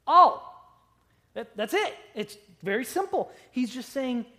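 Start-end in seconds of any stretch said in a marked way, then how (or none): 0.1-0.5 all hit hard and loud; stress on all. Shown as a demonstration. Preacher to congregation, a loud emphatic all. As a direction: punch the single word all. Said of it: "It means".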